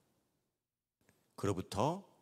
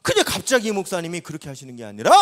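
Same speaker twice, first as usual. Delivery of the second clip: ordinary delivery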